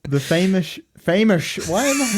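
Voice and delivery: lisping "famous" as "feimush". Real, not acted. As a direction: say "famous" with a slushy lisp. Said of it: "I've developed a lisp".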